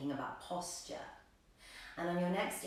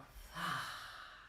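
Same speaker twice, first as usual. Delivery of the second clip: soft, sighed breath out through the mouth; audible exhale; 6 dB quieter than usual. Shown as a demonstration. Yoga teacher showing the class a mouth exhale, soft audible sigh. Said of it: "exhaling out through the mouth".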